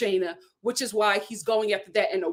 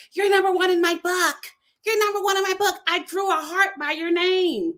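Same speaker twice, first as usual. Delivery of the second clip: higher, syrupy mock sweet-talk; mocking Shane; 8 semitones higher than usual. Shane leaving the pod and sweet-talking Natalie, mocked. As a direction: parody a corny boyfriend's line.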